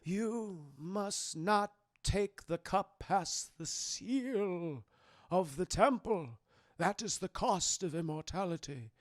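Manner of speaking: slow, soft grave knight voice; hushed, slow warning from the old knight; solemn old knight. Retold or imitated. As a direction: intone it slowly like an ancient guardian. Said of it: "he said".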